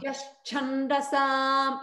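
Yashchandasam sung slowly with the pitch going up; rising intonation. Shown as a demonstration. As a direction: draw out Yashchandasam and lift the tune. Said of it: "it's up".

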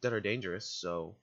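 ordinary talk

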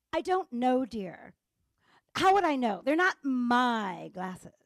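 re-enacted exasperated retort, punched loud; judgmental tone. Wife imitating her retort to husband's question, judgmental tone. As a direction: brush him off with heavy, drawn-out indignation. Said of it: "I was using the tone".

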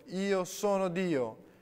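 high, loud, slow final cry; boy's final explosive shriek. A narrator's reading. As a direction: scream it out in a last outburst. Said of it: "il ragazzo strillò".

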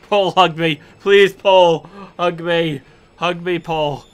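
cling to him in fear; frightened pleading. Real, not acted. Begging a corpse for comfort.

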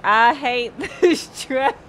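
loud, slow mock yell breaking into laughter; retold scream. Retelling yesterday's hatred of the track, laughing mock yell. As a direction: stretch out the hatred, half-laughing. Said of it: "literally were screaming how much we hated it".

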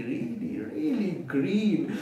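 ordinary delivery

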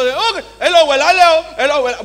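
high, shouted greeting; loud calling out. Acted. Speaker mimicking a greeting for a laughing audience, loud calling out.

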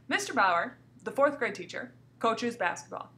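Mister Bauer, pause, the fourth grade teacher, pause; two little pauses. Shown as a demonstration. Teacher repeating sentence for class, pausing at commas. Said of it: "took two little pauses again".

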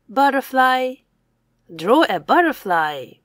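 ordinary talk

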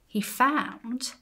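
ordinary delivery

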